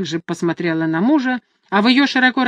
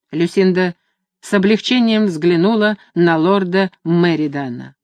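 ordinary delivery, nothing marked

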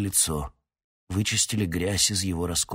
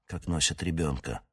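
ordinary delivery, nothing marked

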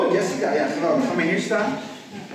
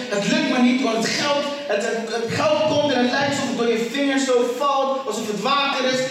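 high-pitched, driving sermon litany; preaching; 4 semitones higher than usual. Acted. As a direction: pile up the frustrations, pitched high. Preaches a list of frustrations.

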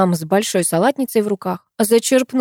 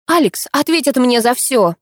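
higher, determined vow; resolute; 6 semitones higher, 3 dB louder than usual. Acted. Narrator vows revenge on Alex, resolute. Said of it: "решительно сказала я".

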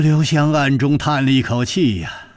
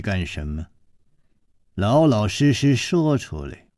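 low, cold interrogation, softer; cold hard woman; 7 semitones lower, 4 dB quieter than usual. Acted. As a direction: question him coldly from the dark. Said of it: "这个声音接着说".

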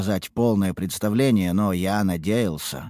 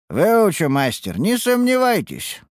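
higher, slow eager promise; eager apprentice; 11 semitones higher, 4 dB louder, slower than usual. Acted. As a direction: reassure the master eagerly.

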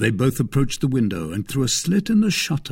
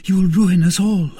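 high, loud, urgent woman's voice; alarmed cry; 9 semitones higher, 7 dB louder than usual. A narrator's reading. Woman stopping man with drawn sword, crying out in alarm. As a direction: cry it out, pitched well up. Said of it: "cried Madame Bonacieux".

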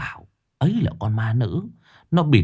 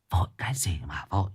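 1 urgent whisper; 3 semitones lower, 7 dB quieter, faster than usual. A narrator's reading. Old woman hushes secretly.

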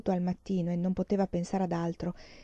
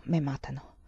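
frightened thought; 5 semitones lower, 5 dB quieter than usual. Retold or imitated. Terrified thought in retelling.

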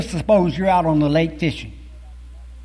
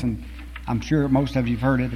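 usual speaking voice

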